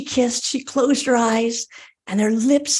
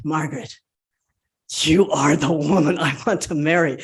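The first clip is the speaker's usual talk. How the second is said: put-on lower, earnest young man; a lightly voiced lover's declaration; earnest suitor; 8 semitones lower than usual. Simon telling Margaret he wants to marry her, earnest.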